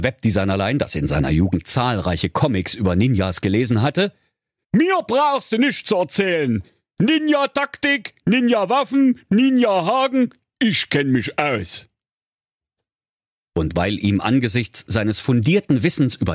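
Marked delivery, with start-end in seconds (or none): 4.7-11.9 high cocky know-it-all voice; boastful character voice. Acted. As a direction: brag like a smug nerd.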